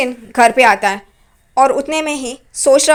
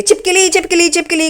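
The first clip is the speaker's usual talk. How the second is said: higher, half-yelled repeat of the word; mock screaming; 4 semitones higher than usual. Aunt spots a lizard on her shoulder, mock screaming. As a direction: retell the panic with a playful half-scream. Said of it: "वो भी चिल्लाना शुरू कर देती है".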